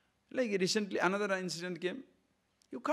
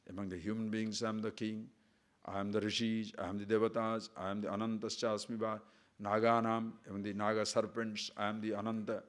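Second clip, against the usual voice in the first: low, even recital on one tone; monotone litany of divine names; scripture recitation; 7 semitones lower, 4 dB quieter than usual. Acted. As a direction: recite the sacred list evenly. Recites and translates a scripture list.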